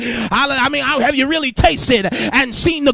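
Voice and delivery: loud, ringing sermon cadence; preaching at full force. Acted. Preacher calling out to the congregation in full force. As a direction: preach it with rising fire.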